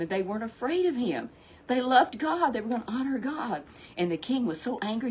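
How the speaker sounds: ordinary delivery, nothing marked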